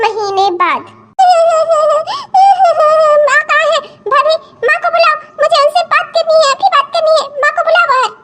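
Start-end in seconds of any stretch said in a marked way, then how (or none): 1.2-3.2 loud acted sobbing on arrival. Daughter arriving at her mother's home, acted sob. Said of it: "तुम कैसे रोने लगी?". 3.3-8.1 higher, upset, insistent pleading; tearful demand. Acted. Married daughter tearfully demanding to see her mother. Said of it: "तुम कैसे रोने लगी?".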